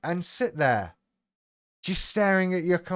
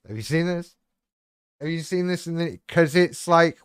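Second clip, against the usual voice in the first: ordinary talk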